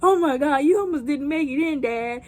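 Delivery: mock hysterical outburst; imitated freaking out. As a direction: act out the kids' frantic relief. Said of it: "They're all freaking out".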